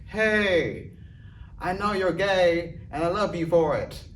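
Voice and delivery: high, drawn-out lady voice; imitated lady. Lady at his set offering support, imitated high voice. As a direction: gush it out in a put-on woman's voice. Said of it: "then the lady was like".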